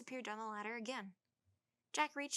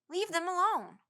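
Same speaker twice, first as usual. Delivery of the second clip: calling; 9 semitones higher, 11 dB louder than usual. A narrator's reading. Willie calling up.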